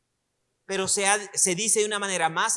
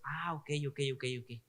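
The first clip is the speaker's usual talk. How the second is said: teacher acting out a reader's sudden understanding, softly; quiet dawning realization; 8 semitones lower, 13 dB quieter than usual. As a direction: lower and much softer, nodding along.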